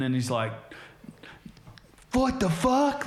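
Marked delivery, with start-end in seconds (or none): 2.1-3.1 very high mock shriek of disbelief; imitated yelling. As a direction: yell it way up high, mimicking outrage. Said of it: "Corey yells at him".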